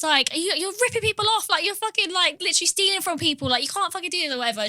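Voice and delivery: heated retold rant, pitch up; shouting at her. Customer swearing at the booth lady, heated shout. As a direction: tear into the clerk, swearing. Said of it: "swearing at this lady shouting".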